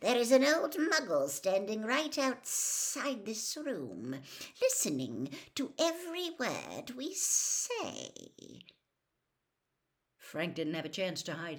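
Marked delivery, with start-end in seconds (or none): 0.0-8.1 icy master exposing an eavesdropper; cold-voiced villain. A narrator's reading. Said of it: "said the voice".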